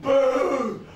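loud drawn-out mock boo; crowd booing. Comic imitating an audience booing him, loud boo. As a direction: boo like a whole hostile crowd. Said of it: "they're all gonna do it".

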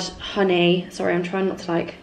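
ordinary talk

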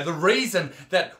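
normal talking voice

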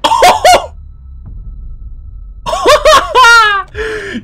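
long high cackling laugh; ecstatic shrieking laugh. Real, not acted. Gamer shrieking with laughter after the win. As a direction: laugh in wild joy.

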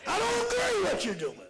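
high, loud shouted preaching; climbing preacher's shout; preaching at full force. Acted. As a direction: whoop it out to the congregation.

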